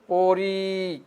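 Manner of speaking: পড়ি stretched, its final ই held; drawn-out ending. Shown as a demonstration. Teacher showing students how to pronounce it, পড়ি drawn out. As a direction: hold the last vowel of পড়ি long. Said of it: "শেষে টান দিবে".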